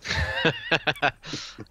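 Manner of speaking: squealing burst of laughter; high laugh. Real, not acted. Laughing at the Boromir joke.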